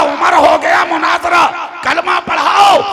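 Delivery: high, loud, ringing shout; proclaimed retold quote. Scholar conceding the debate to Umar, retold as a shout. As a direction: belt it out to rouse the crowd. Repeats quote loudly after rousing crowd.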